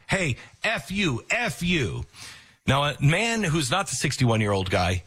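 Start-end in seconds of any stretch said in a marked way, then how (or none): 0.1-2.1 a mild mock yell, higher, held back; imitating a man screaming, without the volume. Basin screaming at the beachgoers, a mild mock yell. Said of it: "Basin screams".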